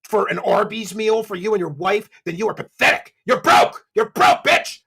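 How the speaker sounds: loud, high scornful tirade building to a shout; angry rant. Real, not acted. Commentator berates a streamer for grifting meals, angry and shouting. Heated insults at another streamer.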